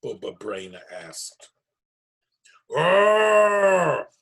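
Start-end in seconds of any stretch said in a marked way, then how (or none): 2.7-4.1 loud, high bear growl shout. Acted. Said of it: "Old Bear growled".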